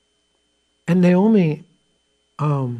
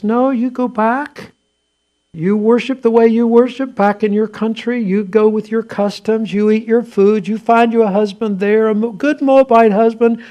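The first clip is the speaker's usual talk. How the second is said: Naomi pleading, raised pitch and voice; urging, higher and louder; 6 semitones higher, 4 dB louder than usual. Acted. Naomi urging Ruth to go home, raised, higher voice. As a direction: push her away kindly but firmly, higher.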